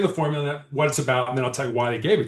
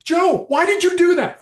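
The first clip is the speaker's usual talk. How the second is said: high, loud accusing why; scolding parent; 6 dB louder than usual. Retold or imitated. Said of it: "What did your parents say".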